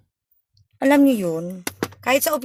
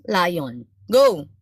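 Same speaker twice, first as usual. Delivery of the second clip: ordinary delivery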